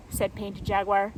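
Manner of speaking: usual speaking voice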